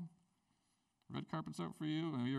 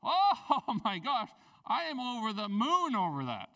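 much louder, higher, laughing and slow; mock overjoyed; 6 semitones higher, 9 dB louder than usual. Pastor imitating a believer thrilled by the Word, laughing gush. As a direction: gush like you won the lottery.

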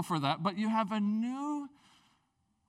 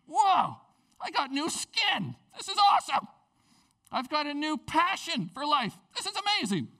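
excited; 10 semitones higher, 5 dB louder than usual. Retold or imitated. New believer's excited inner voice.

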